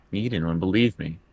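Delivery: usual speaking voice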